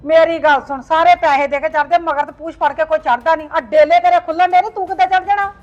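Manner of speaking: angry scolding. Acted. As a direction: berate him loudly, mocking his plans. Mother berates son.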